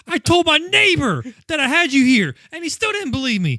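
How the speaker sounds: loud, high hammed-up ranting; mock furious rant. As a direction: rant like a captor no one believes. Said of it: "voicing the angry captor".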